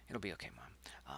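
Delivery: soft whisper, It'll be okay; child whispering to his hurt mother; whispered comfort. Acted. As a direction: whisper it to calm her.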